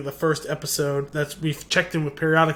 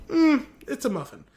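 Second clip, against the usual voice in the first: mock disagreement; 8 semitones higher than usual. Retold dispute over scone or muffin.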